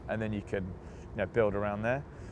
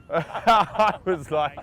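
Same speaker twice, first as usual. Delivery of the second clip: usual speaking voice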